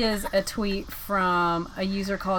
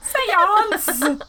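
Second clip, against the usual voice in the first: playful call; 11 semitones higher, 6 dB louder than usual. Pretend. Teasing repeat of the call.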